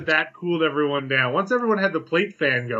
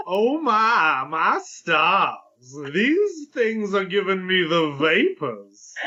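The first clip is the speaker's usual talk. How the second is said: slow, swooning Southern drawl; southern belle; much slower than usual. Pretend. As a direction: fan yourself and drawl like a belle. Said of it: "Canadian impression of a Southern Belle".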